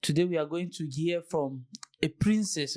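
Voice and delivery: usual speaking voice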